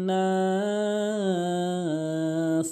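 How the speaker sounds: নাস held very long; three-alif stretch. Shown as a demonstration. Teacher demonstrates the long নাস. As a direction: draw out নাস for three counts. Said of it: "এভাবে টানবেন".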